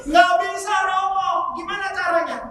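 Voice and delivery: protes bernada tinggi; membantah bingung. Retold or imitated. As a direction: tirukan ibu yang menolak dan minta cara. Ibu membantah saran pastor. (membantah dengan nada tinggi)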